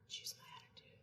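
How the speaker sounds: barely audible whisper to oneself; self-coaching whisper. Retold or imitated. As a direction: whisper it privately, as a reset. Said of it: "I went like this".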